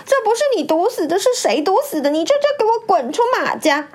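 high, fast wailing accusation. A narrator's reading. First wife blames her rival for the death, wailing. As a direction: cry out the blame in a high-pitched rush. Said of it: "马大奶奶跟着哭喊着说".

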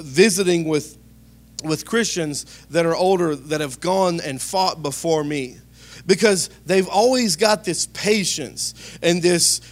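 ordinary delivery

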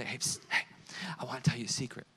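breathy stage whisper of a secret. Acted. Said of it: "Someone say".

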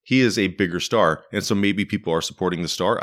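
ordinary talk

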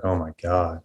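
usual speaking voice

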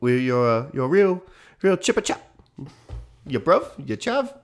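high, loud hammed-up British ruffian accent; mock British accent. Mocking the British as an American, in a high hammed-up British accent. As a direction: toffish British chatter pushed way up in pitch. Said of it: "that's how the ruffians say it".

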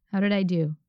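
normal talking voice

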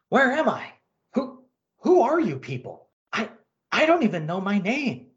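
dazed, bewildered alien pilot; lost, asking who they are; confused pilot voice. Acted.

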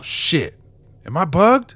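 shocked cry of alarm; alarmed outburst. Acted. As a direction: blurt it in sudden alarm. Shock at learning of bug.

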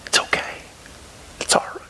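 soft unvoiced reassurance; whispered comfort. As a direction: breathe it like comforting a child. Repeating the dad's comfort softly.

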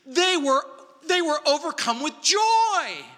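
rising high and bright with overdone joy, loud; preacher pretending the crowd was overjoyed; mock joyful. Shown as a demonstration. Misreading the text on purpose, in mock delight. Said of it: "Oh, wait a minute, that's not what the text says".

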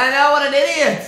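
high, loud, drawn-out agreement; mock exasperated shout. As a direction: shout back, stretching it out high.

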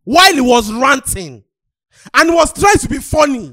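high, loud protest over the others; exasperated shouting. Real, not acted. Defending a ranting man to co-hosts, exasperated shout. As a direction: shout the point across the argument.

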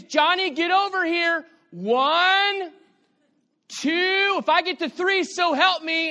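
parent counting. Retold or imitated.

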